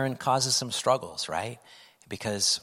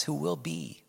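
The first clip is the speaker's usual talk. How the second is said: normal talking voice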